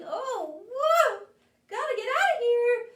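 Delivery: high, loud, fast yelp of alarm; panicked escape. Acted. Bird fleeing the cat, high loud panicked yelp. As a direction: cry out and bolt.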